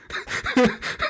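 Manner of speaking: breathy high-pitched burst of laughter. Real, not acted. Gamer cracking up at the dialogue, high laugh. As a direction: laugh helplessly at the joke. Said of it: "I was fucking laughing".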